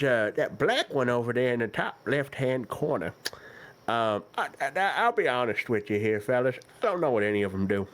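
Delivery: lightly voiced doddering old shopkeeper; very old man. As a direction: ramble on like an old turtle. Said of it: "God, he's ancient".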